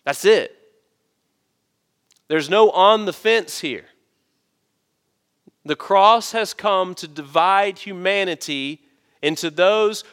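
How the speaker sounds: a preacher pressing the only way; preaching at full force. Acted. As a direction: hammer it home to the congregation.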